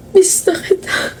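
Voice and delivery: slow, soft, breathless confession; tearful whisper. Acted. Grazel tells her dead best friend she misses her, tearful whisper. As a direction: whisper it through tears.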